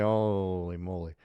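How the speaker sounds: low, drawn-out "holy moly"; retold amazement. Recalling his reaction to two faceoff goals, understated amazement. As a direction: quietly stretch out the mock astonishment. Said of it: "And I was like".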